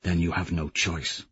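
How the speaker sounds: hushed words to Lorn. Acted. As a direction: drop your voice. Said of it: "I lower my voice".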